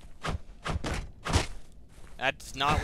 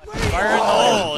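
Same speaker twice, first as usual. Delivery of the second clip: higher, much louder mock warning yell; retold shout; 7 semitones higher, 11 dB louder than usual. Telling friend about guys at the range, retold shout. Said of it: "He's just like".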